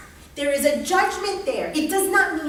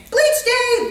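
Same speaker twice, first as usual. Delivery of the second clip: 1 mock cheerful announcement; 8 semitones higher, 5 dB louder than usual. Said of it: "You woke up and said".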